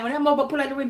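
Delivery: ordinary talk, nothing marked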